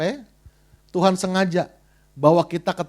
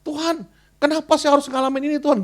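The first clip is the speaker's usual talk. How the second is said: high, lightly whiny prayer; complaining prayer; 8 semitones higher than usual. Retold or imitated. Struggling believer asking God why, mild whiny lament. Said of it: "gitu kalau dalam pergumulan".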